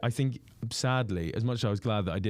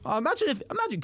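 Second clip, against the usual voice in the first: comedian quote; faster than usual. Retold or imitated. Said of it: "He was like".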